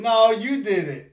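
loud, laughing playful accusation; laughing tease. Real, not acted. Teasing child, laughing.

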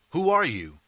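loud, suspicious demand. A narrator's reading. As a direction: demand it of the stranger. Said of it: "people in the crowd demand".

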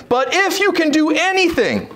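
high, imploring scripture reading; desperate plea. Retold or imitated. Father begs Jesus to help his son, desperate plea. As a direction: raise the pitch in an urgent appeal. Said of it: "You see the desperation in his voice".

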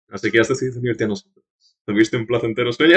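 low, quiet, composed voice; lightly voiced stern stranger; cool mysterious swordsman. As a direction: talk down to the boy calmly. Voices Arikado's lines.

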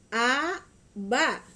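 A and ba each said loud and slow, apart; over enunciating. Shown as a demonstration. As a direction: sound out each syllable, A then ba. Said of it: "ikutin Mama ya".

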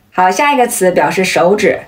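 ordinary talk, nothing marked

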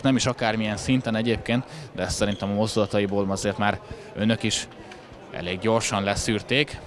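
ordinary talk

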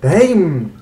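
sudden loud high Damn; loud exclamation. Real, not acted. Gamer reacting to his throw, a loud high Damn. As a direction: blurt it out loud.

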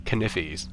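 "Kniffies" said as KNIF-fies, the k sounded, the i short; silly knife. Shown as a demonstration. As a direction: say "Kniffies" with a sounded k and a doubled short i, like a joke word. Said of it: "The silly pronunciation of the word knife".

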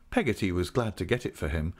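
ordinary delivery, nothing marked

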